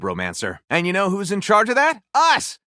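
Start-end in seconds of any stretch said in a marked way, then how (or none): 2.1-2.6 sudden high, punchy yelp; a quick triumphant squeal; excited cry. Real, not acted. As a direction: throw it out with glee. Cheering his own game.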